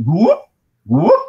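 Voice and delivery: high, loud rising whoop call. Shown as a demonstration. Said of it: "I did one of the whoop calls".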